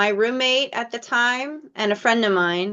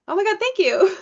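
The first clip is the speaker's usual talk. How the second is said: high, gushing gratitude; suddenly overjoyed and thankful; thrilled thanks; 11 semitones higher than usual. Retold or imitated. Finally thanking the fan director, high and thrilled.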